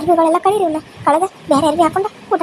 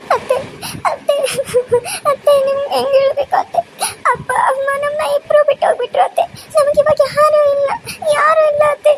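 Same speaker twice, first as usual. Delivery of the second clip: wailing; 10 semitones higher than usual. Acted. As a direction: cry out that nobody is left. Girl tells aunt parents died. (ಗೋಳಾಡುತ್ತಾ ಅಳುವುದು)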